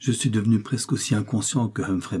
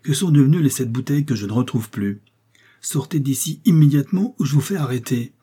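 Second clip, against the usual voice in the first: louder, higher, a doctor's outraged demand; indignant outburst; 5 semitones higher, 5 dB louder than usual. A narrator's reading. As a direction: throw him out with rising outrage, louder and higher. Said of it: "s'écria le docteur indigné".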